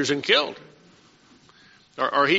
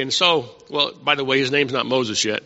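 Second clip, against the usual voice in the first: normal talking voice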